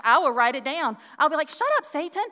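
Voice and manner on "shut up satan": high-pitched, emphatic telling-off; mock rebuke. Preacher acts out talking back to Satan, high rebuke. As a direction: tell Satan off, pitched right up.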